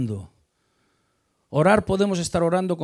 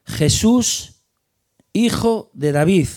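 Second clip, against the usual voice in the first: loud, high cry of «Jesús, hijo de David»; calling out loud; 3 semitones higher, 6 dB louder, slower than usual. Retold or imitated. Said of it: "comenzó a dar voces". (grito fuerte y agudo de súplica)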